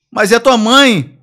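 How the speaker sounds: high, loud, drawn-out objection; hammed-up shocked cry; mock appalled protest. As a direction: stretch it out as a scandalised crook. Appalled kidnapper protesting, retold.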